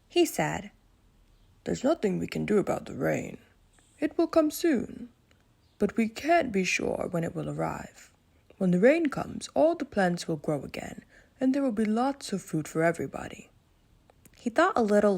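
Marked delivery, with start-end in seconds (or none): 1.6-13.5 calm, wise lion voice; a thoughtful king reassuring his friends; Leo the lion. Acted. Leo gives advice.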